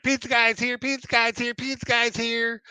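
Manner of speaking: put-on high, sing-song little girl chant; excited toddler. Little girl announcing the pizza guy, high excited chant. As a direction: chirp it like a thrilled two-year-old. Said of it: "She's like".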